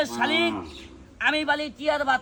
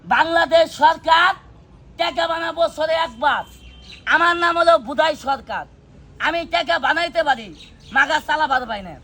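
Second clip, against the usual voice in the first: slow, loud patter to the crowd; slow rhyming street patter; showman rhyme; 2 semitones higher, 5 dB louder, slower than usual. Acted. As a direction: draw it out like a street performer.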